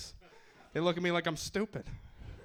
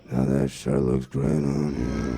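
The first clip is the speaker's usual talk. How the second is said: much lower, slow and louder, leering; creepy low voice; 14 semitones lower, 7 dB louder, much slower than usual. Shown as a demonstration. What the lady hears from his compliment, low and creepy. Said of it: "They hear".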